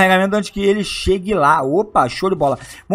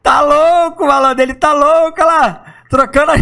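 excited; 7 semitones higher, 4 dB louder than usual. Real, not acted. Watching drunk character stumble.

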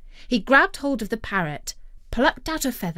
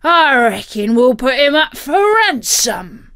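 loud, booming pirate voice; big pirate proclamation; pirate captain, scheming; 4 semitones higher, 9 dB louder than usual. A narrator's reading. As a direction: announce a villainous plan as a pirate.

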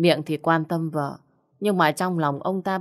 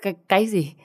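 stumbling, taken-aback question; stammering shock; 2 semitones higher, faster than usual. A narrator's reading. Mother to son after his love announcement, stammering shock. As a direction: stutter the first word in disbelief. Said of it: "ú ớ mãi mới thành câu".

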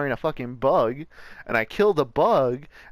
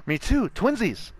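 higher gleeful gasp; excited squeal; 8 semitones higher than usual. Real, not acted. Excited matching moment.

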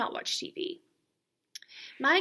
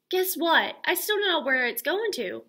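put-on insistent kid; 6 semitones higher, 5 dB louder than usual. Child pesters teacher.

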